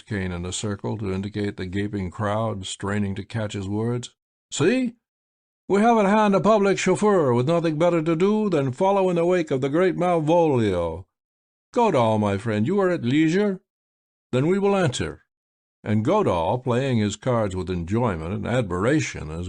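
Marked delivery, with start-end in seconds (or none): 4.5-15.2 higher, polished voice of a blind showman; suave magician. A narrator's reading. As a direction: play the grand, sly magician. Malvolio's voice, higher throughout.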